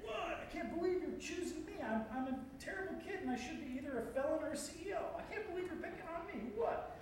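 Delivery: put-on high, fast disbelieving kid; incredulous. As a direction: squeal every what in disbelief. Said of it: "you can say what in an incredulous way".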